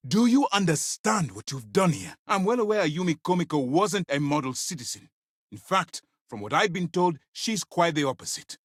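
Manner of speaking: high, heated reprimand; angry scolding. Acted. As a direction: demand they grasp what they did. Commander scolds agent over a killing.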